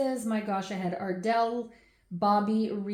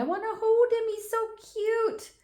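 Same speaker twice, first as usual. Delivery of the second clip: imitating little girl; 12 semitones higher than usual. Mom imitates her little girl meeting her baby brother, sweet high child voice. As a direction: mimic a small child cooing over a baby. Daughter's words retold from video.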